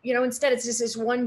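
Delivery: imitated intensity, high and loud; intense voice. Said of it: "intense voice, and he was like".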